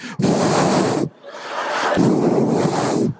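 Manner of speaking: long, hard puffs of air; blowing to cool. Acted. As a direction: blow frantically on a burning sting. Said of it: "I'm trying to cool it off. I'm like".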